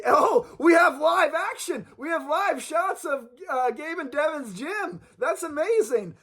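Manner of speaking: high-pitched excited live-action announcement; excited announcer. Pretend. Host interrupting the sponsor ad for live gym shots, excited. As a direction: burst in, voice jumping high, as if thrilled.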